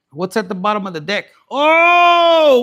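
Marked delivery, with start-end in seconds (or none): 1.5-2.6 sudden high, loud "oh!"; surprised shout. Real, not acted. Reader flipping the last card, surprised shout. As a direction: burst out at the card. Card reveal at deck bottom.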